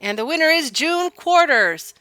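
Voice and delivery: loud, high contest announcer call; booming prize announcer. Acted. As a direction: call the race like a rodeo announcer. Reads contest announcer lines.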